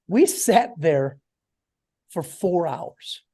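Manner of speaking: very slow, each word stretched; drawn-out emphasis. Real, not acted. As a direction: make the long wait felt in the pacing. Stresses how long they sat.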